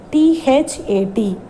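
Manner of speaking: ordinary talk, nothing marked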